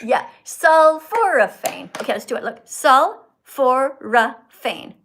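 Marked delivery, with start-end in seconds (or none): 0.0-1.9 Sulforaphane said slowly and loudly, syllable by syllable; slow careful pronunciation. Shown as a demonstration. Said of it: "Four syllables". 3.5-4.9 Sulforaphane said slowly and loudly, syllable by syllable; slow careful pronunciation. Shown as a demonstration. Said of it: "Four syllables".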